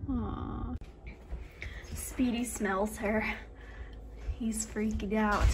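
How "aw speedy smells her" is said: usual speaking voice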